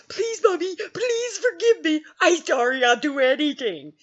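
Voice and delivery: put-on high little-kid begging voice; mock pleading. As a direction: plead with Mommy in a high whine. Child begging mom, acted out.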